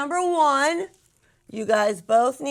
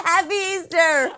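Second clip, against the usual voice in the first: excited; 5 semitones higher, 4 dB louder than usual. Real, not acted. Final shouted holiday wish.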